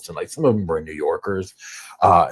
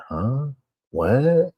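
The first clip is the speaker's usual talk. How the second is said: high, rising puzzled questions; mock bafflement; 7 semitones higher than usual. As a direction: act out a baffled listener, pitch climbing. Imitating baffled listeners of dialects.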